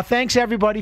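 ordinary talk

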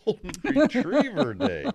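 high laughing echo. Real, not acted. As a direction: laugh it out, amused. Amused echo of the topic.

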